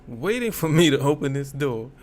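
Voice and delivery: ordinary delivery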